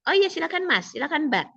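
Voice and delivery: high, lilting examiner voice; examiner giving go-ahead. Acted. Examiner replying to a candidate's request, giving the go-ahead. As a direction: jump up in pitch as an obliging examiner. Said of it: "dengar intonasi penguji kalau sudah turun".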